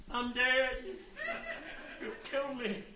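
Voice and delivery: tiny, high, faint possum voice; comic high, soft animal voice; possum playing dead. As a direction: squeak it weakly, pretending to be dead.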